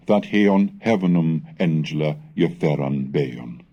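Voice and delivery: ordinary talk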